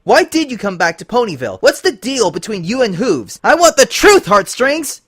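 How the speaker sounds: high, loud accusing shouts; angry interrogation. Acted. Twilight interrogating Lyra about Hooves, angry shouting. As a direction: hammer her with questions.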